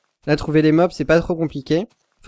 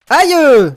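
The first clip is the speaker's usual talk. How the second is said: sharp, high and loud yelp; a yelp at a sudden hit; pained yelp; 13 semitones higher, 12 dB louder than usual. Real, not acted. Pris un coup dans le jeu. (petit cri de douleur aigu)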